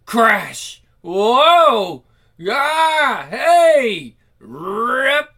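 loud high crash and rip sounds; shouted onomatopoeia; loud sound effects. Acted. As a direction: belt out the comic sound effects. Sound effects read aloud.

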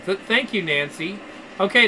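normal talking voice

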